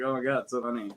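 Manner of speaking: sarcastic. Retold or imitated. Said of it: "that's the tone I'm just getting".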